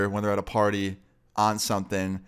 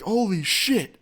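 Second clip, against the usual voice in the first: much higher, stunned exclaim; amazed; 9 semitones higher, slower than usual. Retold or imitated. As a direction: blurt it out in awe. Friend amazed at the news.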